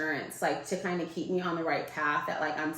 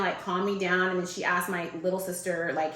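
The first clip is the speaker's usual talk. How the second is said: ordinary talk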